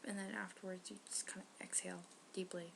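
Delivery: unvoiced hushed whisper. Real, not acted. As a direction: whisper so she can concentrate. Whisper while friend holds breath.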